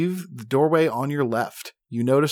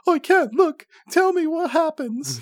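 much higher, slow, timid voice; scared halfling. Acted. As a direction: squeak it out, too scared to look. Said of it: "The halfling covers his eyes".

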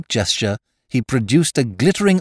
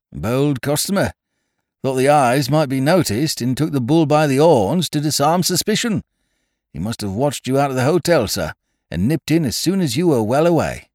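brisk, emphatic police inspector, pitched up; Scotland Yard man; 6 semitones higher, 4 dB louder than usual. Acted. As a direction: report it like a forthright detective. Scotland Yard man voiced in story.

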